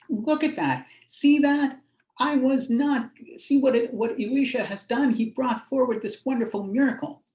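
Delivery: a thrilled woman showing off her miracle; excited woman. Acted. Woman telling the whole town, excited and high.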